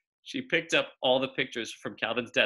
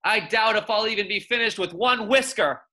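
amused scoffing; 9 semitones higher, 5 dB louder than usual. Acted.

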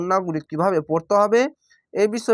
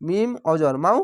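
usual speaking voice

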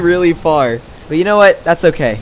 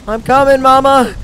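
high, loud call to mama; 8 semitones higher, 5 dB louder than usual. Acted. Baby dibble calling to its mother, loud.

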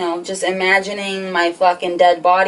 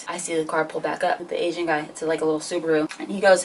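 ordinary talk

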